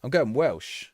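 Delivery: ordinary delivery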